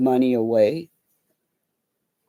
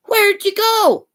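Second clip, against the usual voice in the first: calling out; 6 dB louder than usual. Retold or imitated. Mom calling for missing son.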